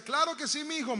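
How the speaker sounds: bright, obliging yes from don Damián; pleased shopkeeper. Retold or imitated. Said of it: "estaba contento".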